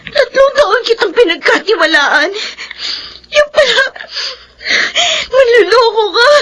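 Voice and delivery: crying, rising to a high hurt shout; tearful accusation. Acted. Betrayed girlfriend to her boyfriend, a crying accusation. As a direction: let the betrayal crack into a yell.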